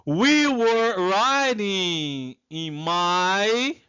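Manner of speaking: drawn-out English sentence left hanging; careful, stretched teacher's reading; slow model reading. Shown as a demonstration. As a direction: read it slowly for students to complete. Teacher reads exercise slowly.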